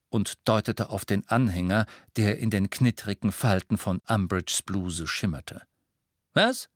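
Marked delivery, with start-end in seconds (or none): 6.3-6.7 sharp, high startled snap; snapping. A narrator's reading. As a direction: snap the word out. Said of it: "schnappte Umbridge".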